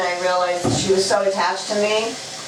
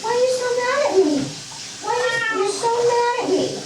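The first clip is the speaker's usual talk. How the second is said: much higher sing-song coaxing; coaxing pet talk; 13 semitones higher than usual. Real, not acted. Owner coaxing her cat after the bath, high pet voice.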